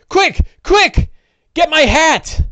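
high, loud frantic urging; frantic rancher. Acted. Tied-up rancher urgently begging for his hat, high and loud. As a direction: blurt it out in a panic. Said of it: "he's like".

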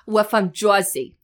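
broad Jersey, like a beehived gum-chewer; Jersey accent. Shown as a demonstration. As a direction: lean hard into the Jersey accent. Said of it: "chewing gum and that you've got a big beehive".

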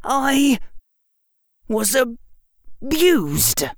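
halting, with a long break mid-phrase; struggling word by word; trouble saying it. A narrator's reading. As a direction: force it out slowly, high-pitched. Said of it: "was having trouble saying it".

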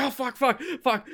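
high, frantic imitated cursing; mock panicked cursing. Dad rushing home at the variant news, high panicked curses. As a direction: play the dad losing it over the variant. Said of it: "screaming in his house".

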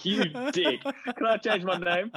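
high, slow whiny complaint; whiny demon. Retold or imitated. Demon begging to change his name, high and whiny. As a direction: whine it like a sulky kid. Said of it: "He's like".

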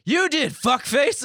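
high, very loud comeback; heated. Retold or imitated. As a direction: yell a childish insult back, acted and amused. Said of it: "got heated, man".

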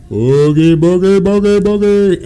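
loud, high spooky ghost wail; ghostly boogie call. Retold or imitated. Prankster jumping from behind a graveyard tree, ghost wail. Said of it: "I come from behind the tree going".

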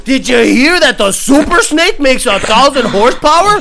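loud, eager rumor-spreader; hammed-up hype gossip; mock gossiping fanboy. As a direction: pass on the hype breathlessly. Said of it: "mocking gossiping fanboys".

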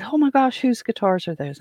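high, thrilled gasp at the guitars; high-pitched imitation of the thrilled guest; excited young man. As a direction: gush over the guitars.

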